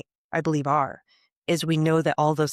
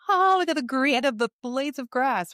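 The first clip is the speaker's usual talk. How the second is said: blissed-out awakener; 9 semitones higher than usual. Retold or imitated. Mimicking blissed-out newly awakened.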